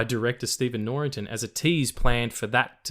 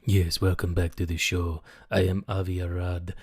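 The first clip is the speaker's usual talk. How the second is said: low, put-on foreign accent of uncertain origin; badly placed accent, lowered pitch, drawn-out ending; Avi Arad accent; 4 semitones lower than usual. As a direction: play a deep-voiced, accented toy boss. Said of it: "where that accent was from".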